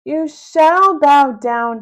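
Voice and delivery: slow, loud, drawn-out yell; a puffed-up tyrant yelling. Acted. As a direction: spell out each word as an angry order. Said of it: "Big Melvin yelled".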